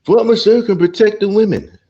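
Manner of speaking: high, loud shouted order; mock march leader. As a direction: bark it like the flustered leader. Mocking march leader again.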